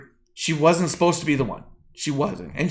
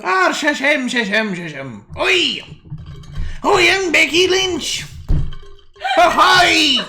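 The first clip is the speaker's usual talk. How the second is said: bad Irish accent; 11 semitones higher, slower than usual. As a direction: ham up a terrible Irish brogue. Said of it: "your Irish accent you try to do".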